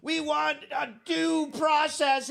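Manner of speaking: high, whining mock voice; mocking whiny Dems. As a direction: whine it like a hysterical Democrat. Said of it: "Dem hysterics".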